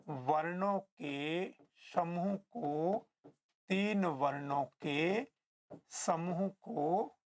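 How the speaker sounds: very slow, stretched words with pauses; drawn-out teacher's dictation, endings held. Shown as a demonstration. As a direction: dictate phrase by phrase for note-takers. Teacher repeats definition for note-taking.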